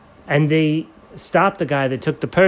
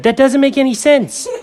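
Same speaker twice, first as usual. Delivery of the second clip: high squeaky hero voice; high excited superhero; Red Ranger voice; 12 semitones higher, 4 dB louder than usual. Acted.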